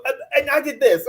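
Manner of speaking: usual speaking voice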